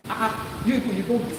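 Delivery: normal talking voice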